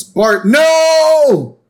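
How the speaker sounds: high shrieked shout; Marge yell. Retold or imitated. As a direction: yell at the kid to stop.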